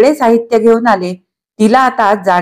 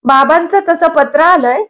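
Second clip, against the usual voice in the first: much higher, cracking question; cracked voice; 8 semitones higher than usual. A narrator's reading. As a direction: voice going thin with shock. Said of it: "चिरक्या स्वरात तिने विचारले". (चिरक्या उंच आवाजात प्रश्न)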